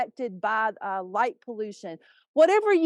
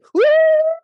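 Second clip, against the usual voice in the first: high wailing loon cry; loon call; 13 dB louder than usual. Shown as a demonstration. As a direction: imitate a loon's long wail. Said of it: "that scared every loon".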